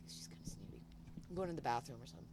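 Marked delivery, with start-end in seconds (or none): 0.1-0.8 hushed private thought; whispered aside. Retold or imitated. Walking past the businesswoman and judging her, whispered aside. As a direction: whisper it as if she might overhear.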